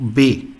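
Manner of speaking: German letter B said "beh"; pronuncia tedesca. Shown as a demonstration. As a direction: say "B" as "bay", the German letter name. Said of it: "B is pronunciation as".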